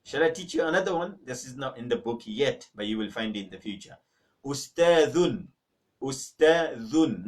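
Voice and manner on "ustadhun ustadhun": slow, clear ustadhun, stressing the dh; teacher models ustadhun with dh; Arabic dh sound. Shown as a demonstration. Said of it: "not dun".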